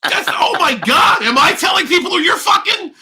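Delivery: mock outrage. Real, not acted.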